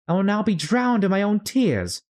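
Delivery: put-on high girlish lament; a little girl regretting her tears; Alice voice. Alice swimming in her tears, high and regretful.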